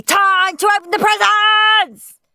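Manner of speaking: loud, high, stretched-out Christmas shout. Acted. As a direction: yell it to wake the house. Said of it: "shouted Henry".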